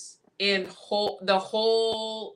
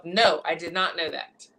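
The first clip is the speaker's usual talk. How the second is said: ordinary talk, nothing marked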